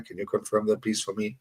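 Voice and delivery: ordinary talk, nothing marked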